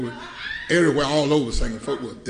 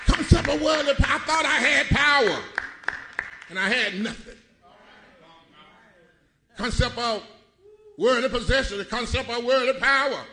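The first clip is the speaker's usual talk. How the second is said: preaching; 7 semitones higher than usual. Acted. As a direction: lift it into full preaching. Preaching at full force.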